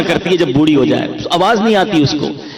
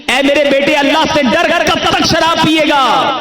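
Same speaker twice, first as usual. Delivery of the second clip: higher, imploring mother voice; pleading mother; 9 semitones higher than usual. Retold or imitated. Mother begging her drunk son to fear God, imploring. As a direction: beg him like a worried mother.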